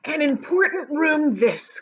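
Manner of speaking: a lightly voiced eager Mister Wonka; Wonka crying out. A narrator's reading. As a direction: announce it proudly like a showman. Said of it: "cried Mister Wonka".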